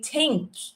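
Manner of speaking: tink with a plain t; Irish tink. Shown as a demonstration. Teacher showing how Irish people say think. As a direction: say think with a hard t. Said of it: "we might say tink".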